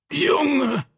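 very high, strained groan; pained, high-pitched appeal to a son. A narrator's reading. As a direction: groan the word out in pain. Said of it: "ächzte er".

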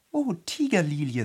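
lightly voiced Alice; Alice addressing flower. A narrator's reading. Alice addresses the Tiger-lily.

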